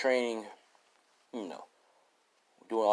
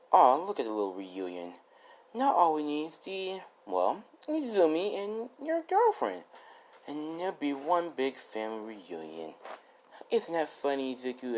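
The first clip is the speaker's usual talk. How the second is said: villain sneering at a family reunion; mocking villain; 6 semitones higher than usual. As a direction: gloat over the captive. Villain Fire Girl taunting captive.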